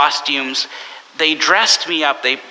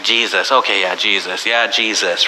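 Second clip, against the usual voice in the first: bored; 5 semitones lower than usual. Retold or imitated. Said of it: "it can kinda get mundane".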